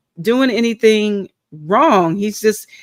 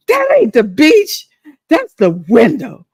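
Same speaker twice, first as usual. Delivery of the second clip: put-on mad old lady; 3 semitones higher, 4 dB louder than usual. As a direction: snap back like an offended nursing home resident. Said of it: "she was so mad".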